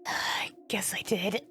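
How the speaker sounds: slow, dazed whisper to herself. Acted. Ruby waking alone after the fall, slow dazed whisper. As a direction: breathe it out half awake.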